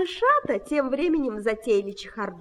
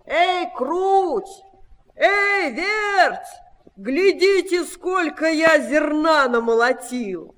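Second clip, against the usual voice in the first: raised, drawn-out call; 5 semitones higher, slower than usual. A narrator's reading. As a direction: holler to the mice, voice lifted. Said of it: "и крикнул".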